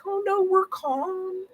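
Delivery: a spirit pretending to be gentle and loving; fake calm spirit. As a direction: lull them with a falsely soothing coo. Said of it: "they're all like".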